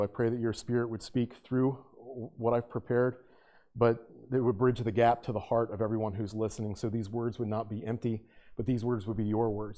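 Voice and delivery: low, soft, reverent praying voice; prayer. Real, not acted. Leads the congregation in prayer.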